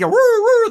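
high, loud siren wail; mock siren noise; imitated siren. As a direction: whoop like an emergency siren. Imitates sirens of the imagined crash.

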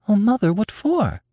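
high, gasped question; astonished daughter's gasp. A narrator's reading. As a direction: gasp it out in high-pitched dismay. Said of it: "gasped Nanny".

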